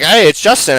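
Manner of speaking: upbeat friendly. Retold or imitated. Cheery greeting to wary client.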